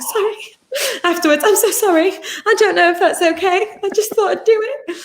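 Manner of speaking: high, laughing mock apology; high-pitched flustered apology after a kiss. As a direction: gush it out sheepishly through laughs.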